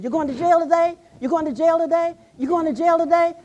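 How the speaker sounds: put-on high, loud, eager children's call; children calling out eagerly.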